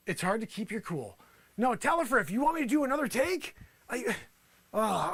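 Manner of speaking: ordinary talk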